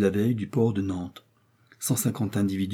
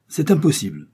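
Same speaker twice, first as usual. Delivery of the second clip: curt, higher and louder refusal; cold, incisive no; dry and cutting; 6 semitones higher, 5 dB louder than usual. A narrator's reading. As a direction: slam the door on any mercy. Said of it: "d'une voix sèche et incisive".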